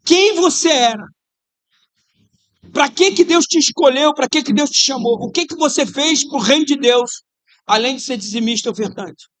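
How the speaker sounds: loud, high preaching questions; fiery preaching. Real, not acted. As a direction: challenge them hard. Preaching at full force.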